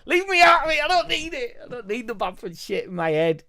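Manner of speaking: high squeaky mock voice, loud at the start; mock high squeal. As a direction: squeal it like a cartoon refusing to listen. Mock refusal to hear Bamford talk.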